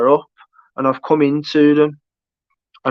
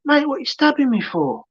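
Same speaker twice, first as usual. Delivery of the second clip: imitating stabbed film character; 10 semitones higher than usual. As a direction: act out the film victim's bewildered plea in a raised pitch. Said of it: "he's like".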